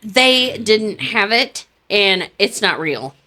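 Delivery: put-on slow, drawn-out clueless girl; slow deadpan girl. Friend mimics the girl reporting blinker fluid isn't real, slowly. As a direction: stretch it out like a dim report. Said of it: "she was like".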